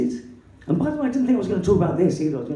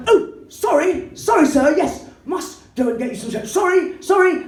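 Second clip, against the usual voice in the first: high, loud, frantic mock apologies; Basil Fawlty; 11 semitones higher, 4 dB louder than usual. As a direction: grovel hysterically with sarcastic over-politeness. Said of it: "then I turned into Basil Fawlty".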